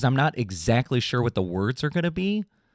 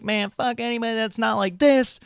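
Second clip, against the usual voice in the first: high sneering mock-punk voice; high-pitched mocking voice of gatekeeping punks; 9 semitones higher than usual. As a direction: parody a snobby punk kid, pitched way up. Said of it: "the punks these days are like".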